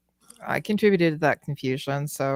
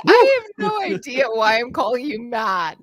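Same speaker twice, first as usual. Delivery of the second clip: 1 laughing quote; 6 semitones higher, 7 dB louder than usual. Retold or imitated. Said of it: "And I'm going".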